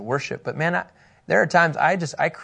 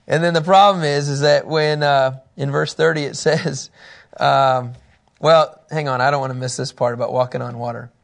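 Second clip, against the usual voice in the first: ordinary delivery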